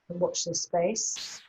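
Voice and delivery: ordinary talk, nothing marked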